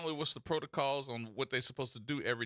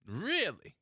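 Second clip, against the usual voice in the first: mock surprise; 6 semitones higher than usual. Mock disbelief at board member.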